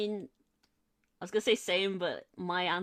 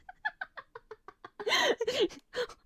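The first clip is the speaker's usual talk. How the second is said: high helpless laughing. Real, not acted. Helpless fit over the meme.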